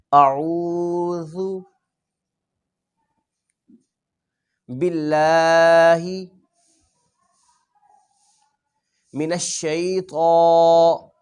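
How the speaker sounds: very slow Quranic recitation, each word sustained. Shown as a demonstration. Teacher leads pupils in reciting, very slowly. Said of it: "آپ میرے ساتھ پڑھیے گا".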